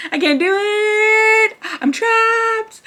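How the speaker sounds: high, slow, crying shout of panic; panicked cry. Retold or imitated. Trapped in the MRI tube, crying out in high panic. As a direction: wail it like you're trapped and terrified. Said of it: "I was freaking out".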